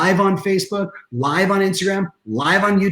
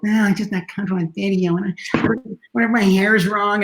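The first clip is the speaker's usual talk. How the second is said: higher, mock fretful voice; reluctant people; 5 semitones higher than usual. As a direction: imitate someone making nervous excuses. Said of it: "I still have people like".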